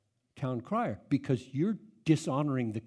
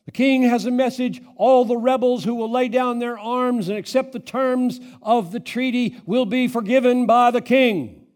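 loud, high royal proclamation; town crier; 8 semitones higher, 9 dB louder than usual. Acted. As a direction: announce the king's terms to the crowd. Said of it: "people who do this preaching".

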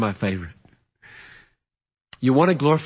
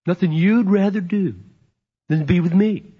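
delighted wife; 7 semitones higher, 5 dB louder than usual. Retold or imitated. Wife's delighted imagined reply.

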